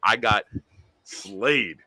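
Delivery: normal talking voice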